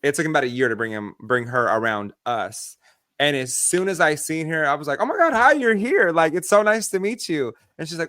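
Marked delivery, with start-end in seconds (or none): 5.0-7.5 high, loud gushing hello; excited greeting. Retold or imitated. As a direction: gush over meeting her for the first time. Greeting the nephew's girlfriend.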